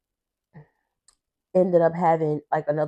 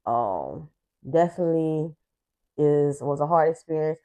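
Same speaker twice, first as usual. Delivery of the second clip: ordinary talk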